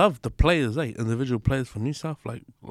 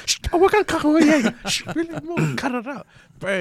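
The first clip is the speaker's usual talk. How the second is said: much higher, louder, laughing mock referee hushing the players; 6 dB louder than usual. Retelling Wally Lewis fight, hammed-up referee shushing players. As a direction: ham up a referee breaking up a brawl, laughing through it. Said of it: "the referee looks like a someone".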